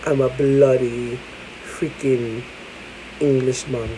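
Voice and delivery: low-key imitated English accent; slow, lightly put-on Englishman's accent. Telling viewers how it sounded, mock English accent. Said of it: "I'm speaking like".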